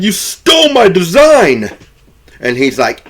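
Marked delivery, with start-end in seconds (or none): 0.0-1.7 loud, high retold outrage; mock angry accusation. Retelling Bill confronting the man who copied him, mock angry. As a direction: act out an indignant accusation. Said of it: "about to whoop him".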